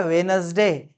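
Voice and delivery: वेडनेसडे without the first d, three syllables "WE-nes-day"; another wrong pronunciation. Retold or imitated. Teacher quotes what some people say, वेडनेसडे as "we-nes-day". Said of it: "कोई बोलता है".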